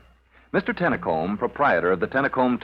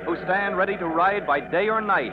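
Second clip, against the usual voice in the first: higher, dramatic radio announcer read; old radio show opener; announcer intro; 7 semitones higher than usual. Acted. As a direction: sell the heroes with a big announcer voice.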